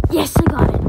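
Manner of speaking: loud, high burst of triumph; triumphant yell. Real, not acted. Player celebrating getting it, triumphant yell. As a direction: shout it out in victory.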